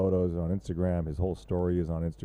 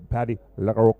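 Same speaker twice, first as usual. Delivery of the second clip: mock French accent; 3 semitones higher, 8 dB louder than usual. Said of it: "we're gonna just do French accents for everybody".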